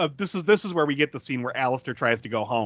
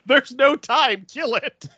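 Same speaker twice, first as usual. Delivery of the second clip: mock cop order; 8 semitones higher, 3 dB louder, slower than usual. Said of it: "And he goes".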